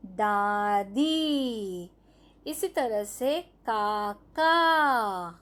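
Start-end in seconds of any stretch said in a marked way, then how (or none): 0.1-1.9 दादी drawn out very slowly, each syllable clear; slow spelled-out word. Shown as a demonstration. Teacher to young pupils, slowly drawing out दादी. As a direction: stretch दादी out slowly for the class. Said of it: "क्या बन जाएगा?".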